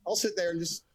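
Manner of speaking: usual speaking voice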